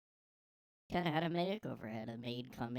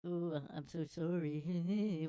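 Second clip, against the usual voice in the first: higher, drawn-out mocking whine of apology; 4 semitones higher, slower than usual. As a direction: mimic a fake, overdone sorry. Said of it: "he put out a little".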